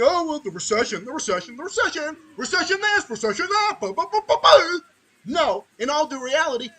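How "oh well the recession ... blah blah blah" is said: sing-song mockery building higher and louder to a laughing blah; hammed-up mock chant, rising to a loud laughing blah; mocking recession talk. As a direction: parrot the recession worriers till it falls into blah blah.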